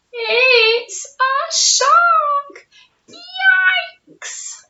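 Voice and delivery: high, slow alarmed cry; hammed-up shark panic; mock alarm. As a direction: squeal it out in storybook fright. Narrator discovers shark, alarmed.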